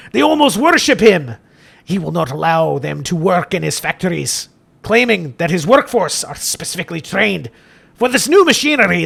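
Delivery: theatrical Scottish priest, rolled Rs; hammed-up Scottish sermon; Father Jeremiah. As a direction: declaim in a put-on Scottish accent, punching exclamations. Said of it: "Father Jeremiah's gotta be Scottish".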